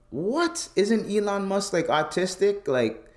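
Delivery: high-pitched baffled outrage; incredulous protest. Retold or imitated. Man retells arguing with Twitter over a banned word, high incredulous protest. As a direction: protest in a squeaky, disbelieving pitch.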